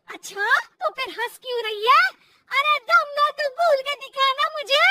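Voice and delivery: loud, pressing dare; bully her loudly into it; forceful angry challenge. Acted. Mother-in-law challenges daughter-in-law to speak, angry. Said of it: "सास के जोर देने पर".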